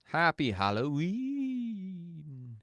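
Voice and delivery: mock-ghostly long-held ending; drawn-out holiday sign-off. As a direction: stretch the last word into a long gliding wail.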